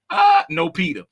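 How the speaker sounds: usual speaking voice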